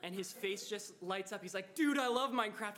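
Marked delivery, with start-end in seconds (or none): 1.7-2.8 suddenly higher and louder, thrilled; light up with sudden joy; excited. Retold or imitated. Quiet guy suddenly lights up.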